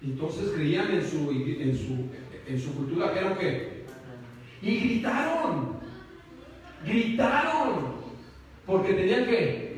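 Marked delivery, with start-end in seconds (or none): 4.6-7.9 "gritaron" lento y alargado, con tono alto; énfasis dramático. Real, not acted. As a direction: estira la palabra "gritaron" subiendo la voz. Preacher dramatizes the screaming. ("gritaron" recalcado, lento y agudo)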